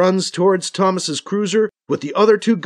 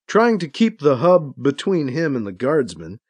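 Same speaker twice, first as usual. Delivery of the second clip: ordinary delivery, nothing marked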